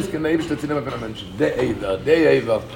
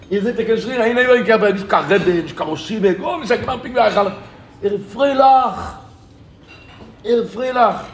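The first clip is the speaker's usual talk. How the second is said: raised, insistent storyteller's exclamation; emphatic amazement; 5 semitones higher, 3 dB louder than usual. Retold or imitated. Speaker marvels that the man is freilach, emphatic amazement. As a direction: exclaim it higher, in astonishment. Marvels at paralysed man's joy.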